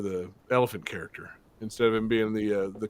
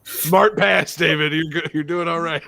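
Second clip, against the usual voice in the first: teasing praise; 4 semitones higher, 6 dB louder than usual. Real, not acted. Teasing a guest's refusal.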